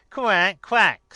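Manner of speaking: loud, high derisive quacks; mocking duck quack. A narrator's reading. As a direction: quack in someone's face like a duck. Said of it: "says the duck".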